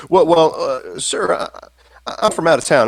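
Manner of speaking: high, hesitant Texan stammer; Texan accent. Acted. Slim explaining himself to the stranger, hesitant Texan accent. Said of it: "with your Texan accent".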